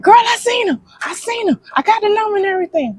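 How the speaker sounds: high-pitched, rushed imitation of an excited friend. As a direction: blurt the big news like a thrilled girlfriend. Friend's excited phone news, retold.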